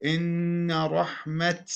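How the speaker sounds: slow recitation, "إن رحمة" stopped on t; pausal ta. Shown as a demonstration. Teacher showing a test stop, "إن رحمة" with final t. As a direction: hold the nn of "إن", end "رحمة" as rahmat. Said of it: "فتلفظها ماذا؟ تاء".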